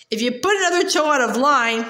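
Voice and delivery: ordinary delivery, nothing marked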